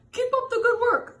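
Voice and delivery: high, loud cheering on; cheerful encouragement. Acted. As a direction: cheer him on brightly. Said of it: "said Mister Seahorse".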